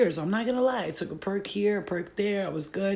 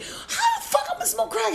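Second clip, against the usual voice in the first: high indignant squeal. Retold or imitated. Indignant reply to friend.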